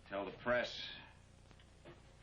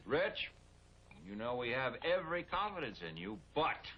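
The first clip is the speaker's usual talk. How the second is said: mock patronizing mayor, pitched higher; imitating the mayor; 6 semitones higher than usual. Police chief mimicking the mayor to his friend, mock patronizing. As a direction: mimic the mayor's hedging preamble, high and pointed. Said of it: "who prefaced every sentence with".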